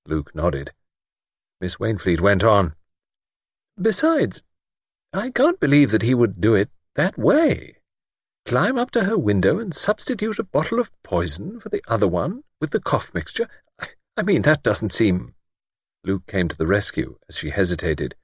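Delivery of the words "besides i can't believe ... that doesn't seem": high, hesitant lady's voice; doubtful older lady. A narrator's reading. Miss Wainfleet defending Jim to Luke, doubtful and hesitant.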